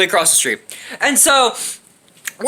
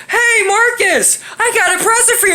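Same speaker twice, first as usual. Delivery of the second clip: Jules's teasing call; 10 semitones higher than usual. Retold or imitated. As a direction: imitate a prankster calling sweetly to his victim. Imitates Jules teasing Marcus.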